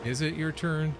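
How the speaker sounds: lightly squeaky mocking reply; high mock voice. Answers the taunt in voice.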